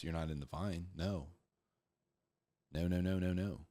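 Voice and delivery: normal talking voice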